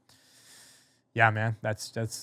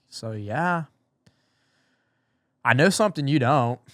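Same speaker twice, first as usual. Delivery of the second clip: imitating a friend dangling a secret, building louder; teasing; 3 semitones higher, 3 dB louder than usual. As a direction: tease it out, pausing before the reveal. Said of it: "he's, like, really nagging it on".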